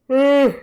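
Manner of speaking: high, loud yawn. Real, not acted. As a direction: let out a big yawn. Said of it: "stop freaking yawning".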